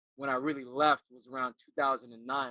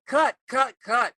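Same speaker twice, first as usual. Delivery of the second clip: high, loud call to stop the scene; acting teacher stopping the showcase scene, loud; loud teacher's cut; 10 semitones higher, 11 dB louder, slower than usual. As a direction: yell cut like a director halting the actors. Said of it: "She's like".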